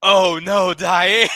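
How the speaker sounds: laughing dismay. Retold or imitated. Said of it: "And then I said".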